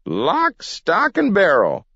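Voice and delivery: put-on very high old-timer's crow; triumphant. A narrator's reading. Gramp confirms the whole property is bought, triumphant. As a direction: crow each word separately in triumph. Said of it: "said Gramp triumphantly".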